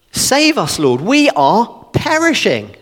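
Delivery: high-pitched plea, mildly urgent; disciples' desperate cry. Acted. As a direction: cry out for rescue, falling away by the end. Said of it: "They're shouting to Jesus that they're dying".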